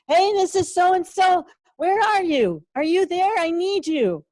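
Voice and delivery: high-pitched call through the house; yelling from another room. Acted. Kid calls out to the teacher over her computer, yelling. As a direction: call out urgently for someone out of sight. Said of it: "will be yelling in the other room".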